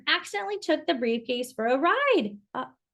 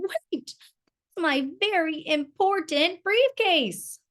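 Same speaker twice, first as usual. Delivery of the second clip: bellowing; 6 semitones higher than usual. A narrator's reading. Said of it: "bellowed Mister Brown".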